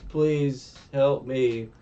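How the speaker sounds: slow, weak plea for help; a dying woman begging; lightly voiced victim; feeble plea. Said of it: "She's like".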